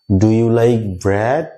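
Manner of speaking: very slow, bread with an open e; slow model sentence. Shown as a demonstration. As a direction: drill it slowly for the class. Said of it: "এইভাবে উচ্চারণটা তোমাদেরকে করতে হবে". (ধীরে ধীরে model উচ্চারণ)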